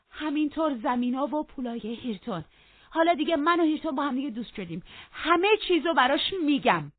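high, loud, insistent tirade; heated defiance. Acted. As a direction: press on defiantly at full voice. Catherine carries on defying him loudly.

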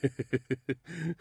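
sailor's chuckling outburst; hearty staged chortle; bursting into laughter. A narrator's reading. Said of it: "ملوان زد زیر خنده".

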